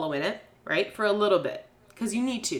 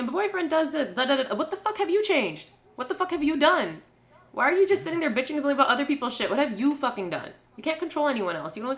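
angry rant; 6 semitones higher than usual. Real, not acted. Heated rant about complainers.

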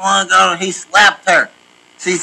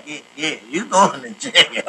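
softer retold quote, dissolving into laughs; amused, low and chuckling; breaking into laughter; 6 semitones lower, 5 dB quieter than usual. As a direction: trail off laughing as you quote him. Retelling a joke, breaking into laughter.

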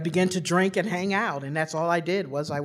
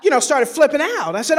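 ordinary talk

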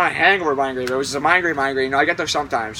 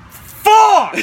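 sudden pained curse, high and loud; stubbed toe yell; 8 dB louder than usual. Retold or imitated. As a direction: yell it like you just stubbed your toe. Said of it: "I'm just like".

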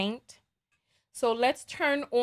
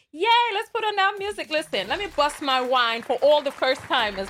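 bright, fast hype call; rally the first timers to dance; yay, party time; excited hype; 3 semitones higher, 3 dB louder than usual. Real, not acted. Celebrating first-time donor.

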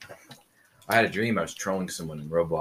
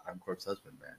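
corpse husband bit; 6 semitones higher, 18 dB quieter than usual. Pretend. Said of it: "continuing the corpse husband bit".

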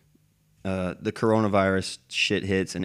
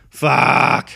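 loud, higher burst of frustration; exasperated curse; 4 semitones higher, 8 dB louder than usual. Retold or imitated. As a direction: spit it out like it just hit you. Retelling his reaction to arrest.

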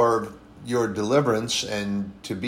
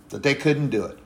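ordinary delivery

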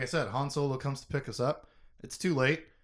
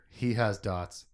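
ordinary talk, nothing marked

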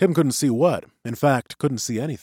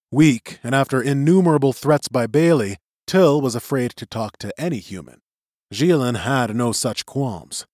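ordinary delivery

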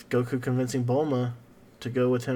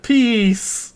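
high, loud put-on call; imitated victory cry; 9 semitones higher, 6 dB louder than usual. Anime hero striking a victory pose, mock exclaiming. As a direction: sing out the word in a mock cheer. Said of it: "So he just went".

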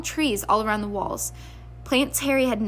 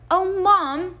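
high, whiny groan; whining teen; 8 semitones higher, 5 dB louder than usual. Acted. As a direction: whine in protest. Son whining.

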